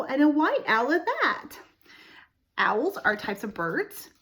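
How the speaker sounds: usual speaking voice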